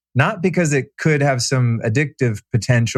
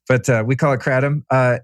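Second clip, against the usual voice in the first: ordinary talk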